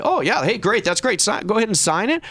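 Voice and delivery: high, sarcastically eager yes; mock agreement. Adviser mocks what salesman expects, sarcastic eagerness.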